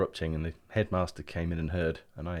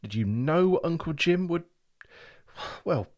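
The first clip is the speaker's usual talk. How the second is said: louder, rising, tripping over words; spluttering; 9 semitones higher, 5 dB louder than usual. Acted. Boy accusing the clock, spluttering with outrage. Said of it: "I spluttered".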